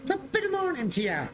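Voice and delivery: high, hammed-up Irish brogue; mock Irish greeting up high; Irish leprechaun. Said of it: "I'm a leprechaun. I'm Irish".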